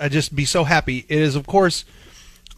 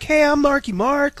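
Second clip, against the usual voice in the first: much higher put-on tough-guy voice; 10 semitones higher than usual. Telling co-hosts about a customer, high put-on voice. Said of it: "he kinda had a voice like this".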